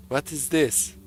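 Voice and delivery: attempted Swedish accent; bad Swedish accent. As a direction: have a clumsy go at a Swedish accent. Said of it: "I can't do the Swedish accent".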